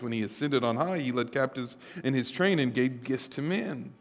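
preacher quoting scripture softly; quiet scripture quote. Acted. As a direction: drop your voice for the verse.

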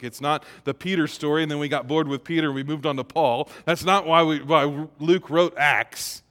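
ordinary talk, nothing marked

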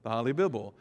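the Holly Bibble with short o and short i; Holly Bibble as a glitchy reader says it; mispronounced Holy Bible. Shown as a demonstration. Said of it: "it really would say Holly Bibble".

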